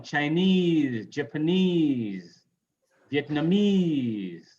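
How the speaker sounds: Chinese, Japanese, Vietnamese with long stressed endings; stress on final ESE. Shown as a demonstration. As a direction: slowly stretch the last syllable of Chinese, Japanese, Vietnamese. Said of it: "Stress E's in noun".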